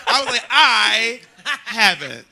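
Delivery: blurting it out through a laugh; loud, laughing admission; laughing reply. Real, not acted. Admitting it too quickly, laughing. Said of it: "I don't know why I jumped in".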